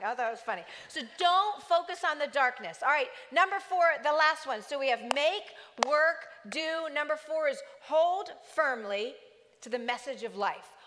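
usual speaking voice

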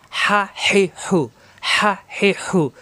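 হা হে হু with a raspy throat squeeze; squeezed raspy ha. Shown as a demonstration. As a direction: rasp হা হে হু from mid-throat. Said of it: "গলা চিপে উচ্চারণ হবে, ফ্যাস ফ্যাস আওয়াজ হবে".

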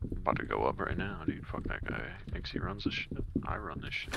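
whispered trash talk. Real, not acted. Trash talk under breath.

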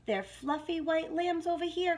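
high, mildly excited calling; storybook hedgehog calling friends over; excited cry. A narrator's reading. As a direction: cry out a happy discovery. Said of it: "cried little hedgehog".

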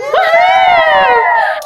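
loud, high, long whoop; rooting cheer. Shown as a demonstration. Teacher roots along with the children, whooping loudly. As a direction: whoop as if cheering on the home team. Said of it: "Can you root again?".

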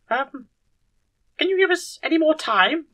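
very high, hesitant question; tentative request. Acted.

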